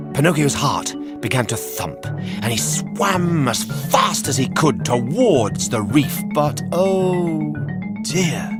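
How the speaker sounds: ordinary delivery, nothing marked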